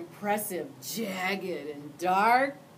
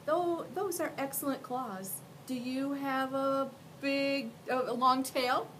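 put-on high, hesitant little boy; a polite kid asking carefully; young boy voice; 6 semitones higher than usual. Boy asks Mac.

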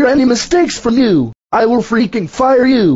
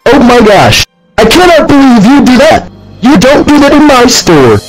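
full-on angry screaming; furious manager; 8 dB louder than usual. Acted. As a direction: scream him out of the store. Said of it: "got screamed at by the manager".

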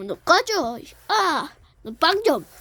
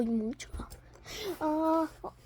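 ordinary delivery

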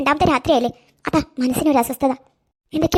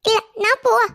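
put-on much higher toddler voice babbling; baby talk; 8 semitones higher than usual. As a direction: babble like a small child to grandma. Toddler insists on going. (കൊഞ്ചി പറയുന്ന കുഞ്ഞ്)

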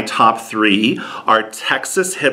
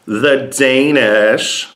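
ordinary delivery

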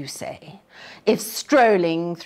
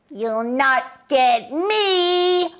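higher, very slow taunt; defiant piglet; 8 semitones higher, 3 dB louder, much slower than usual. A narrator's reading. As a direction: tease the wolf from safety. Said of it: "the piggy cried".

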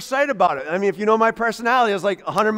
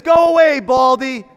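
a high, loud shouted jeer; full shout; 3 semitones higher, 7 dB louder than usual. Retold or imitated. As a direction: yell it at the top of your voice. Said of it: "and they're yelling".

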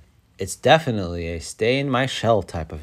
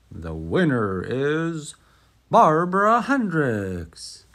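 slow build, then a higher, louder name called out; announcer; 5 semitones higher, 3 dB louder, slower than usual. Acted. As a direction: announce the winner like a grand MC. Mayor announces the winner.

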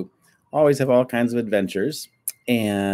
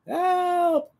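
a sudden squeaky cry for help, much higher; startled cry. Acted. Cat falling down the stairs cries out, high panicked yelp. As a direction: yelp it as you tumble.

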